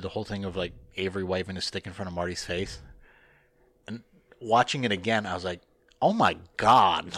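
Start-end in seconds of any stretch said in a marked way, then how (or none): 6.0-7.1 loud, drawn-out retold "oh my God"; mock-stunned outburst, stretched out; shocked exclamation. Host retelling his shock at rewatching the Avery video, stunned.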